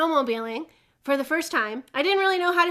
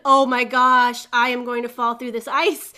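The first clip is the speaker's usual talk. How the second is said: drawn-out, louder retold dread; freaking out; 7 dB louder than usual. Rider spinning on thin ice, mock panic. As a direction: act out the doomed realization. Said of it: "I'm freaking out".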